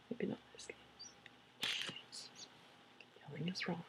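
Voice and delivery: hushed, slow joking whisper; whispered aside. Real, not acted. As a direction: breathe it like a secret.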